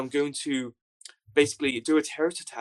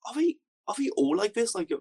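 imitating a guard's question; 9 semitones higher than usual. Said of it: "one of the lower guys who spoke English goes".

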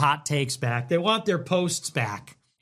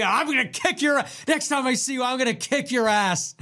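mock threat; 8 semitones higher than usual. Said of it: "he called me".

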